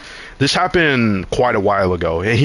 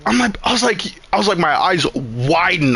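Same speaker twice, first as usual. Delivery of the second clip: ordinary delivery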